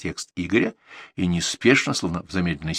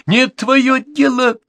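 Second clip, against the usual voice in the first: outcry; 7 dB louder than usual. Acted. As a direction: cry it out at him, dragging the first words. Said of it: "вскрикнул Борзенко".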